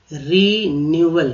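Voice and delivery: रिन्यूअल said slowly and clearly; slow teaching pronunciation. Shown as a demonstration. Teacher modelling रिन्यूअल slowly for students. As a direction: draw out रिन्यूअल for learners. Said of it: "यहाँ फिर E W आ गया".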